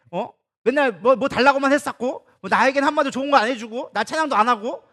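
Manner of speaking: God venting that he's ignored, building to a near shout; sulking God. Acted. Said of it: "속된 말로 삐진 거야".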